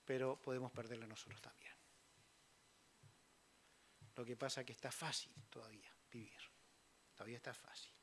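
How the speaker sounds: hushed warning whisper. Real, not acted. Preacher drops to a warning.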